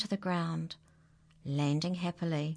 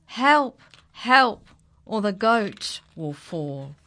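calling for help; 3 semitones higher, 9 dB louder, slower than usual. Acted. Goat slipping, calling for help.

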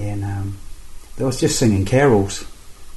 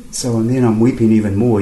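ordinary talk, nothing marked

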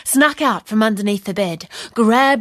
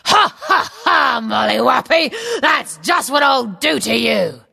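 giant voice. A narrator's reading.

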